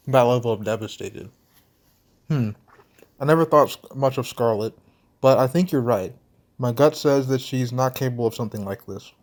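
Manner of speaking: ordinary talk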